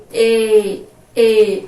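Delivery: E said loud, with the closed ê; a loud E with the closed ê, as in fechado; closed e sound. Shown as a demonstration. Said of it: "Ela tem um som fechado".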